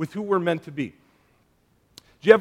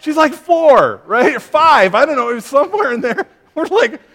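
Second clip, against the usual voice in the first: higher and louder, words through real laughter; laughing while talking; 8 semitones higher, 10 dB louder than usual. Real, not acted. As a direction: still cracking up as he tells it. Laughing while retelling the story.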